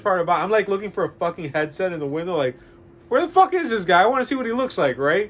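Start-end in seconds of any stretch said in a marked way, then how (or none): 3.1-5.0 high, exasperated questioning; exasperated curiosity. Retold or imitated. Customer hunting in the window for the clerk, high and exasperated. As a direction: demand to see him, worked up.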